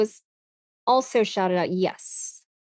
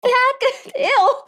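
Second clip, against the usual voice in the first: tsundere disgust; 12 semitones higher, much slower than usual. Pretend. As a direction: squeal it in mock disgust. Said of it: "I'm supposed to be a tsundere".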